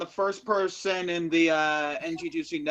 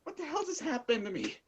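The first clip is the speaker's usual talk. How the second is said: higher, bewildered after the spell; confused; 10 semitones higher than usual. Pretend. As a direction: come to, baffled and lost. Snapping out of possession.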